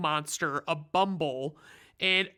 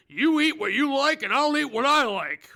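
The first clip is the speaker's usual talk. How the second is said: much higher, louder cartoon prospector; Yukon voice; 8 semitones higher, 5 dB louder than usual. Recapper voicing Yukon snapping back at Hermie, loud hammed-up miner. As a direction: belt it like a stubborn old miner. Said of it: "I hope that voice is okay".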